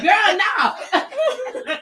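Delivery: laughing no. Retold or imitated. Reacting to flickering lights.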